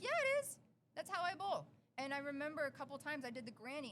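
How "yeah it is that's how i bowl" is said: high, loud mock-indignant comeback; defiant retort. Retelling an argument with her date over granny bowling, defiant. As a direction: fire back, pitch leaping up.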